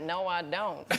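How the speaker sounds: softly imitated Southern nurse; Southern farm accent. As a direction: put on a soft Midwest-Southern accent. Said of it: "the farm accent sounds a little bit Midwest and Southern".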